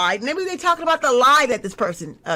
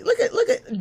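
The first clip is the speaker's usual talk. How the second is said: laughing; 12 semitones higher than usual. Real, not acted. Laughing at silly person.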